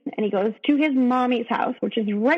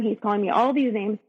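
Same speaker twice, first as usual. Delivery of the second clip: normal talking voice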